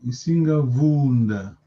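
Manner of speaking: "Isinga Vunda" low and slow, long "uu" in "Vunda"; slow teacher's model, "Vuunda" drawn out; long vowel. Shown as a demonstration. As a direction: stretch the first vowel of "Vunda". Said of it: "Long vowel is the soul".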